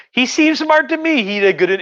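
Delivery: high-pitched protest of innocence; mock defensive excuse. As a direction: squeal a defensive excuse, hammed up. Emperor excuses his bad pick.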